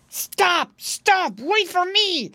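high, loud storybook shout; chasing fox calling out, high-pitched; fox's cry. A narrator's reading. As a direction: call after someone running away. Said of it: "cried the fox".

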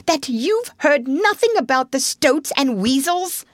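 high, incredulous outburst; mild shout. A narrator's reading. Rat asking Toad about stoats and weasels, mildly shouting. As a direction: build to an astonished question, pitched high. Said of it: "shouted the rat".

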